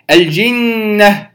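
الجنة with a long held nasal on the doubled N; ghunna demo. Shown as a demonstration. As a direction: hold the nasal NN in الجنة for two counts. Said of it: "هذه نون مشددة أصلية". (الجنة بغنة حركتين)

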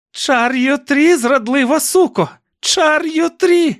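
very high, loud, slow hag's scream; crazed aunt screaming. A narrator's reading. Aunt cursing her niece before the crowd, shrieking howl. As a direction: spit the curse in a shrieking frenzy. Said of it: "загорлала Корделія".

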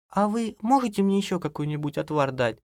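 high woman's request; pleading wife. A narrator's reading. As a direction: ask politely in a high voice. Wife asks granny for brew. (жена просит, высоким голосом)

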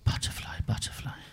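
soft, airy, unvoiced whisper; light, delicate whisper. Shown as a demonstration. Comedian showing how lovely the English word sounds, delicate whisper. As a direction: whisper it like fluttering wings. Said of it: "as it flies beautifully and lightly".

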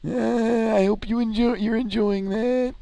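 reply in a squeaky falsetto; high falsetto. Acted.

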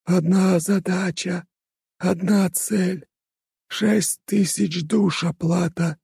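high, slow detached djinn chant; indifferent rustling voice. Acted. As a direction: murmur it without any feeling. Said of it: "безразличным голосом прошелестели".

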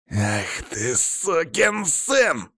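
very drawn-out, strained snarl climbing high; enraged roar stretched word by word; growling rage. A narrator's reading. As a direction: growl the curse with fury. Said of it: "зарычал Павел".

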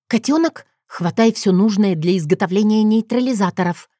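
usual speaking voice

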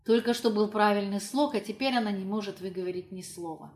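usual speaking voice

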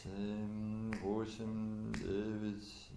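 ordinary delivery

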